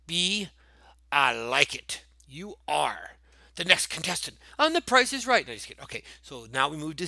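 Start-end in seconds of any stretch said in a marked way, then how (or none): 2.3-5.5 mock game-show announcer. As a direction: build to a high, excited contestant call.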